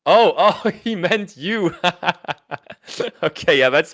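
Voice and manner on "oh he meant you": ordinary delivery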